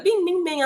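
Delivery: high sung bing notification chime; phone ping imitation. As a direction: mimic the phone chiming.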